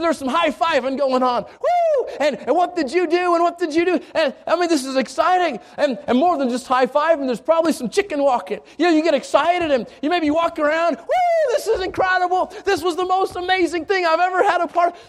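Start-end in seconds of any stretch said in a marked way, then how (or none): 1.4-4.0 whooping high, then eager questions; high whoop then eager chatter; excited cheer. Acted. Disciples high-fiving after mission. 11.0-15.0 high whoop, then higher excited talk; a jubilant whoop that stays high. Acted. Disciples celebrating, chicken walking.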